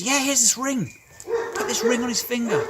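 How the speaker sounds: high, loud, hurried joy; excited father calling out; urgent happy orders. Acted. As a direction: rush the servants, beaming with joy. Father calls for the ring.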